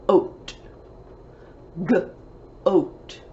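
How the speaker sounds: "G" as a short guh, apart from "Oat"; sounded out. Shown as a demonstration. Teacher building a rhyme for pupils, "G" and "Oat" sounded separately. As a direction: blend slowly, pausing between "G" and "Oat". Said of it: "Let's put g".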